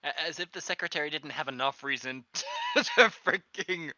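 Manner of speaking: words breaking up into laughter; helpless giggles, rising high and loud; laughing while talking. Real, not acted. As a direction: struggle to get the line out through real laughter. Cracks up while making a joke.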